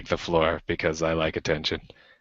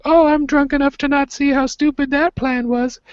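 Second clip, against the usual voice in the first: much higher, tipsy young woman imitation; squeaky mock of a drunk girl at the bar; 3 dB louder than usual. Drunk girl voiced in story.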